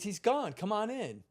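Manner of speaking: high imitated babysitter voice; coaxing call. Babysitter coaxes hesitant boy inside, high-pitched coaxing. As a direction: coax a hesitant child through the door.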